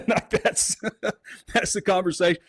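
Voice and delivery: high, breathy laughing through the words; amused laughter. Real, not acted. Laughing after story.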